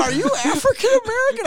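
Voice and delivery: squeamish high-pitched ask. Retold or imitated. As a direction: blurt it up high and loud. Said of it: "I go".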